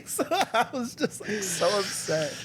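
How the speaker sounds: slow, high, through laughter; real laughter breaking into the words; laughing. Real, not acted. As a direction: tell it while cracking up. Laughing while telling own misfortune.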